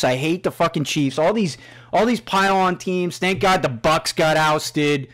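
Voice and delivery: ordinary talk, nothing marked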